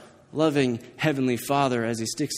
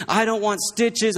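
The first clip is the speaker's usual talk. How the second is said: mild mock scream; 6 semitones higher than usual. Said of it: "Crying and shaking his head and screaming".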